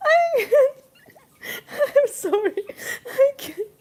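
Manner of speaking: high, loud, tearful laughing voice; laughing to tears. Real, not acted. Streamer who loves puns overcome by one, laughing to tears. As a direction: squeal helplessly, words breaking up with laughter. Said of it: "I'm seriously crying".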